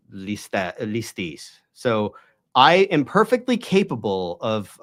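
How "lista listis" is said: "listis" as lee-STEES, both vowels ee; modern Greek pronunciation. Shown as a demonstration. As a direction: say "listis" with the stress on the last syllable, lee-STEES. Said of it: "and then became".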